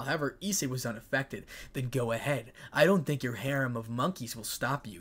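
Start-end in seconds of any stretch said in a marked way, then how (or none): none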